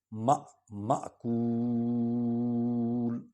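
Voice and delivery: مأکول very slowly with a clear glottal catch; hamza catch. Shown as a demonstration. Teacher shows the hamza catch. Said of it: "وہ حمزہ کی آواز نکالتے ہیں".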